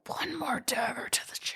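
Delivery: breathy dramatic whisper; whispered aside. Acted. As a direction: whisper it like a secret. Dramatic aside about plot twist.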